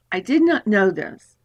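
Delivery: ordinary talk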